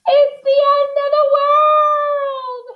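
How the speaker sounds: very high, slow, drawn-out wail; panicked shout. A narrator's reading. Missus Watson as the bed falls, high panicked shout. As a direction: shriek it, stretching the last word. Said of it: "shouted Missus Watson".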